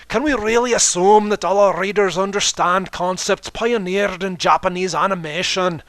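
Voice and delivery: grand stage magician proclaiming; Trixie voice. Acted. Trixie voiced, boastful third person.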